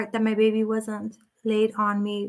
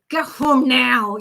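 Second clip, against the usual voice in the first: slow, louder firm order to a lost dog; mock telepathic command; 5 dB louder, slower than usual. Calling her runaway dog home by telepathy, slow firm command. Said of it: "and be like".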